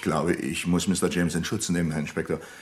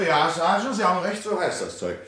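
eager relief; 12 semitones higher than usual. Acted. Hillman, relieved, confirming the sergeant's drug name, high and eager. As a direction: seize on the right name with relief.

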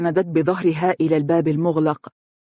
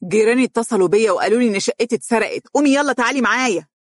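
fast, louder higher yell; 6 semitones higher, 3 dB louder, faster than usual. A narrator's reading. Stepmother yelling her flat was robbed, fast loud yell. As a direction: scream it in her face. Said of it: "صرخت بوجهها".

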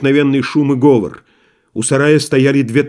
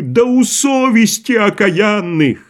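shouting crowd; 9 semitones higher, slower than usual. A narrator's reading. Крик из толпы.

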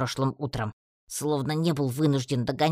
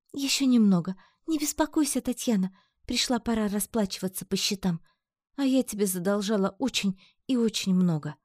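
high character voice, reassuring; wry, weary Amazon; 7 semitones higher than usual. A narrator's reading. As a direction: voice her high, making light of exhaustion. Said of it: "криво усмехнулась она, тяжело опираясь".

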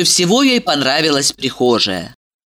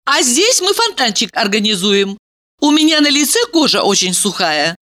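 put-on high girly voice; bubbly sorority girl; 6 semitones higher than usual. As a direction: chatter brightly. Valeria voiced.

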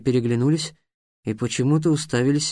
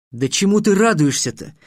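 contemptuous; 5 semitones higher, 4 dB louder than usual. A narrator's reading. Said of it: "презрительно сказал Трикс".

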